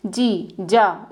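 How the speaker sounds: जी, जा stretched out very slowly; teacher drawing out जी and जा for beginners; slow letter sounds. Shown as a demonstration. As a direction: sound out जी then जा, very drawn out. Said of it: "इसमें दो meaning आता है".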